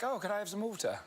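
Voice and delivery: mock British accent. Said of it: "Can you do a British accent".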